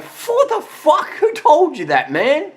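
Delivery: high, laughing mock disbelief. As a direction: squeal it out in laughing outrage. Retold reaction to diet myth.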